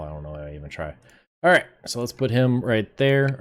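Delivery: ordinary talk, nothing marked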